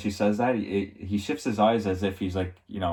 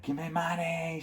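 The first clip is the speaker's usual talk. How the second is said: high, slow, quiet begging voice; mock homeless lady; 8 semitones higher, 6 dB quieter than usual. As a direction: creep it out like a pleading stranger.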